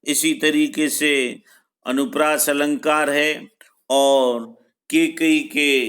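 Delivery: ordinary talk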